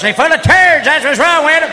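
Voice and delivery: high, loud punchline; shouting. Acted. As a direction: yell it, laughing at your own joke. Comic shouting about the chips.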